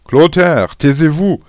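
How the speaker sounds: high, loud schoolboy shout; shouted reprimand. A narrator's reading. Pupil playing teacher silences a classmate, shouting. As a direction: cry out bossily like a little teacher. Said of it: "a crié Agnan".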